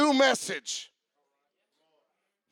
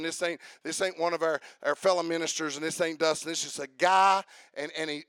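usual speaking voice

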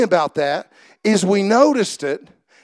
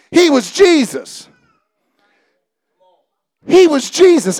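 preaching at full force; 5 semitones higher, 3 dB louder than usual. Acted. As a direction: hammer home who Jesus was. Preaching about unbelief, shouting.

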